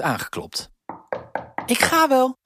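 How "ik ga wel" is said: put-on higher, louder girl's call; girl calling out eagerly. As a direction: call toward the door. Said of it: "riep Elise".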